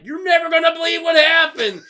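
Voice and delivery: high, loud excited shout; excited news. Retold or imitated. Coworkers greeting him back from day off, loud excited shout. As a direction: burst out with the big news.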